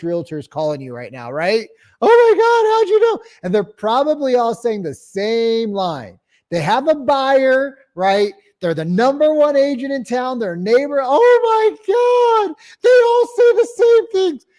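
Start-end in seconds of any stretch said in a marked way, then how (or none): 2.0-3.2 much higher, louder mock-amazed squeal; squeaky amazed seller. Mocking the seller's amazed reply. 11.0-14.5 much higher, louder mock wail; exasperated shout.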